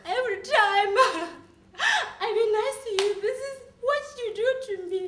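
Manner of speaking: high-pitched, crying complaint; tearful hurt. Acted. Telling a boyfriend how he treats her, tearful. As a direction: protest through tears.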